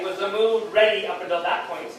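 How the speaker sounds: normal talking voice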